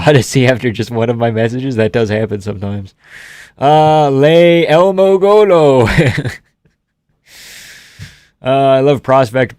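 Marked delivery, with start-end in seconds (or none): 3.6-5.9 slow, high, loud goofy misreading; deliberately butchering a chatter's username; mock mangled name. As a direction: drag out "Le Elmo Golo" like a silly garbled name.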